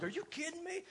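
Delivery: high, soft, delighted are you kidding me; amazed disbelief. Retold or imitated. Pastor telling church of his daughter, high amazed delight. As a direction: let it rise up high in happy disbelief.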